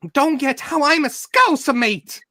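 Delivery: high, slow mock Scouse accent; squeaky put-on Scouser voice. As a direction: ham up a Liverpool accent. Mocking friend's Scouse accent.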